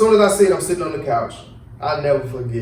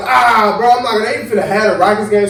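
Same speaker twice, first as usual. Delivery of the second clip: quick, raised, dismissive rush; louder, rapid-fire denial; doubtful disbelief; 5 semitones higher, 4 dB louder, faster than usual. Retold or imitated. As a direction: brush it off fast, voice up. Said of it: "So I'm like".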